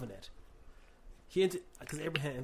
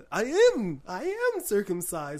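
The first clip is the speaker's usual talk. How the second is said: vampire voice; 5 semitones higher, 10 dB louder than usual. Shown as a demonstration. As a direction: play it like a vampire count. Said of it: "you gotta do it with a".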